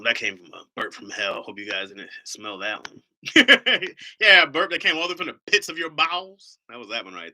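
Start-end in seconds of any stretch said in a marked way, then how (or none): 3.2-4.1 big burst of laughter; loud laugh. Real, not acted. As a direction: crack up loudly. Laughing at own burp.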